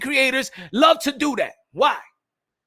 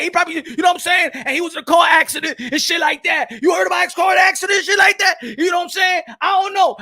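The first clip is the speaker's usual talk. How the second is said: outraged intruder act; 5 semitones higher than usual. Acted. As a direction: rant indignantly like a meddling hater. Acts outraged intruder disputing the salary.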